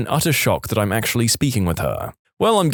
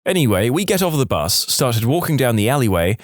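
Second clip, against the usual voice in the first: ordinary delivery, nothing marked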